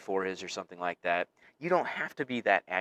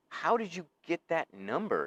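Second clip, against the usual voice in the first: advisor voice; 7 semitones higher than usual. Retold or imitated. Advisor questioning student, retold.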